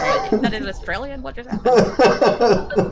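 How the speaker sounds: loud, slow, laughing what-just-happened; baffled laughing outcry. Retold or imitated. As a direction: react as if the accent makes no sense. Party reacts to bad accent.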